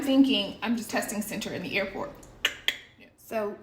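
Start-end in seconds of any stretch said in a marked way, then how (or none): none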